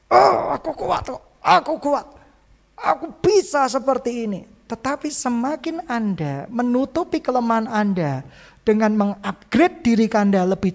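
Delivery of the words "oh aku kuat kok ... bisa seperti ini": high, strained pretend toughness; mock brave front. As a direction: put on a fake-strong front. Mocking the falsely strong person. (pura-pura kuat dengan suara tinggi)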